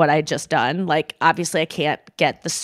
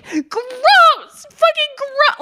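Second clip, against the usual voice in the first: very high shriek of disgust; kid yelling gross; much slower than usual. Retold or imitated. Young girl screaming at relatives asking about boyfriends. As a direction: shriek it like a kid who hates the question. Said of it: "I would always go".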